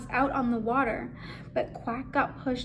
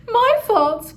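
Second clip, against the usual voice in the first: much higher and louder, guilty cry; cried out; 9 semitones higher, 10 dB louder than usual. Acted. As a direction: cry it out in dismay. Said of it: "Quack cried".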